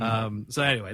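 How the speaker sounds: ordinary delivery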